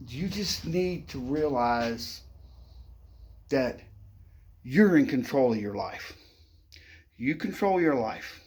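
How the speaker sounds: ordinary talk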